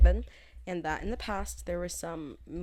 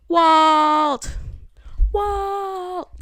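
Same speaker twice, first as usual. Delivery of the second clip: long, high, drawn-out mock yell; high-pitched shout stretched over one long syllable; calling out; 11 semitones higher, 11 dB louder than usual. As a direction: holler the name as if searching for someone lost. Calls out a TV character's name.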